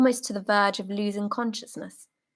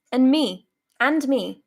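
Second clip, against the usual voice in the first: higher, louder calls of "And me"; eager calls; 4 semitones higher, 7 dB louder than usual. Acted. Soldiers clamouring for lemon slices, loud eager calls. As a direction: pipe up to get your share.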